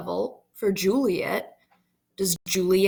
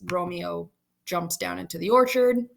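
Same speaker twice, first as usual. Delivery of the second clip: ordinary talk, nothing marked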